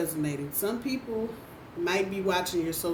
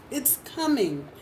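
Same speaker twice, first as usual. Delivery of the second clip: normal talking voice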